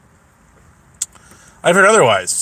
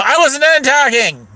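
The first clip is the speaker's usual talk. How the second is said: higher-pitched indignant mimicry; raised, huffy mock quote; mock protest; 7 semitones higher than usual. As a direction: imitate an offended interruption. Acts her interrupted protest.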